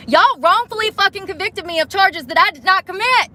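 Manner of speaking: high, outraged charge; real indignation; bitter accusation. Real, not acted. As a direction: hurl the accusation at the cops. Accusing police, pitch jumps.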